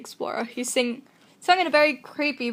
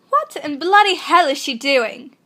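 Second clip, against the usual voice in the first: louder, put-on British accent; 3 semitones higher, 5 dB louder than usual. Trying out accents before the story, hammy British. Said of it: "I sound like Draco from Harry Potter Musical".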